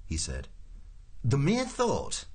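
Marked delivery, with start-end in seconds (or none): 1.2-2.2 high, slow, indignant official; growled protest. A narrator's reading. Prosser protests to Ford's warning, slow indignant growl pitched high. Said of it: "growled Mister Prosser".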